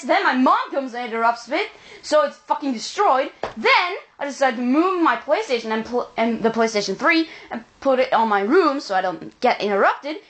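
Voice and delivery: ordinary talk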